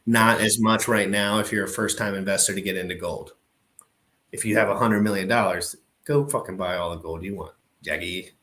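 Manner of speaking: ordinary delivery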